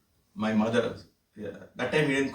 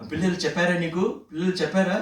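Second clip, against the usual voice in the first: put-on higher and louder, like an excited small child; childlike eager Swami; 7 semitones higher, 4 dB louder, much faster than usual. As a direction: pester him with it like an eager five-year-old. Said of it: "like a five-year-old".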